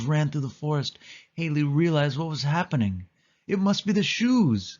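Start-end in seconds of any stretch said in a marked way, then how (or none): 3.5-4.8 put-on much higher girlish voice; high excited girl's voice; Haley's voice. A narrator's reading. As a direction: lift the voice high into a young girl. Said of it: "said Haley".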